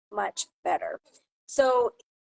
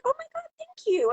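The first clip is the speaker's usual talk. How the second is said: very high, retold squeal of thanks; gushing surprise. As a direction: imitate a delighted gift recipient, pitched way up. Retold reaction to a gift.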